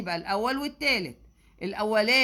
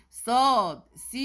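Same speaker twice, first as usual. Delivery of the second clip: drawn-out letters; 2 semitones higher, 6 dB louder, much slower than usual. Real, not acted. Teacher reading out triangle letters.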